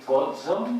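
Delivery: pozzo with a voiced ts, the z buzzing; pronunciation demo. Shown as a demonstration. Lecturer demonstrates the voiced z in pozzo. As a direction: show how pozzo is said, z voiced like dz. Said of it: "hay que decir".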